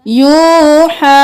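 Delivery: slow, chanted tajweed recitation; Quranic recitation. Shown as a demonstration. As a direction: chant it slowly, stretching the vowels. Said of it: "यहाँ पर भी या को नहीं पढ़ना है।".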